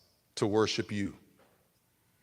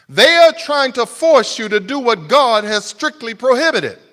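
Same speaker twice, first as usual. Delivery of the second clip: loud, higher pulpit fervour; full-force preaching; 9 semitones higher, 12 dB louder than usual. Acted. Preacher building to a peak before the church, loud fervent preaching. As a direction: drive the point home to the congregation.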